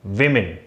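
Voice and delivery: विमेन said as "wi-min"; correct pronunciation. Shown as a demonstration. Teacher contrasts विमेन "wi-min" with the singular for students. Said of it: "ये वुमेन नहीं होगा".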